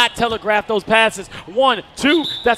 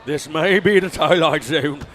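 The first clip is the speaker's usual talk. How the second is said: put-on British accent; 5 semitones lower than usual. Commentator stunned by the score, mock British accent. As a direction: slip into a mock British accent to hush the booth. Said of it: "starts to speak in a British accent".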